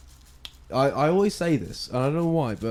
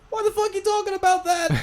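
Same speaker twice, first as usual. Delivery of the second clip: very high-pitched character squeal; Panther; 3 dB louder than usual. Acted. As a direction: put on a tiny squeaky character voice. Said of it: "what does the Panther say".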